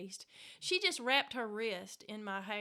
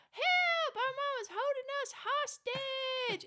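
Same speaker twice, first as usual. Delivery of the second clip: very high, slow, dragged-out wail; hammed-up hostage cry; 5 dB louder, much slower than usual. Sister faking a hostage plea out the window, very high and slow. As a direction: stretch every word like a fake victim. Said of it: "dragging her words out".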